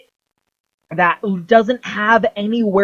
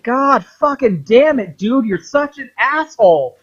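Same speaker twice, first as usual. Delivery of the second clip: much higher, exasperated yell; exasperated outburst; 7 semitones higher, 4 dB louder than usual. Retold or imitated. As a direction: groan it out, fed up with him. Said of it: "Charles is like".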